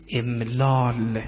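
very slow, drawn-out syllables; stretched, deliberate teacher's enunciation; careful sounding out. Shown as a demonstration. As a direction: sound the word out slowly for students. Teacher carefully sounds out root word.

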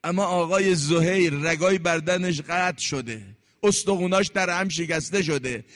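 ordinary talk, nothing marked

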